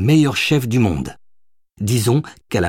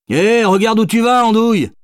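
much higher, louder shouted warning; cri de passant agacé; 13 semitones higher, 5 dB louder than usual. A narrator's reading. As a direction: call out sharply at a careless pedestrian. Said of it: "lui lança un bobo". (interpellation criée, très aiguë et forte)

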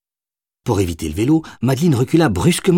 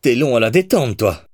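mild, higher-pitched reproach; reproche; 3 semitones higher than usual. A narrator's reading. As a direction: chide him to keep your composure. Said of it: "lui reprocha-t-elle pour garder sa contenance". (léger reproche, voix plus aiguë)

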